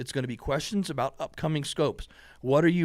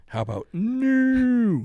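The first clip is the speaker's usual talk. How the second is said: high, slow, drawn-out no; mock refusal; 10 semitones higher, 5 dB louder, much slower than usual. Real, not acted. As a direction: tease him with a flat refusal. Refuses the optic.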